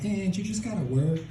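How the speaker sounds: put-on Mexican-accented dad, rising at the end; dad's Mexican accent. Immigrant dad advising his son, Mexican accent. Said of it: "my dad totally sounds like that".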